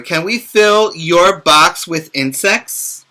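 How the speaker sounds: lively storybook read-aloud; picture book voice for young listeners; reading to children. Acted. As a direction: read it brightly to little kids.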